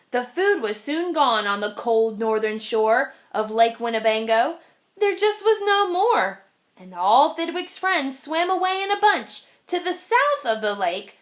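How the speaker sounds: ordinary delivery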